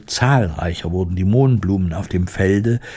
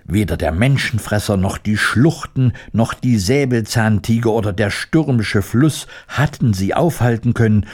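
usual speaking voice

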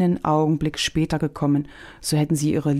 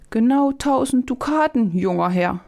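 put-on much higher voice of an old vegetable seller; old market woman; 9 semitones higher, 3 dB louder than usual. A narrator's reading. Old vegetable woman names her price to young Stefan, high voice. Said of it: "antwortete die Gemüsefrau".

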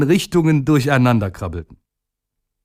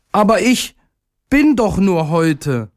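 little day in despair; 8 semitones higher than usual. A narrator's reading. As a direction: cry out high and desperate. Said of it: "rief er".

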